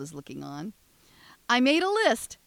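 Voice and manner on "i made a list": higher, louder eager boast; John voice. Acted. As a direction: proudly announce it. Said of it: "John says".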